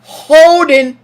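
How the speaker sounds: "Holding" called out high and loud like the referee; imitating referee. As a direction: bark "Holding" the way the ref announces it. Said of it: "That's how he says it".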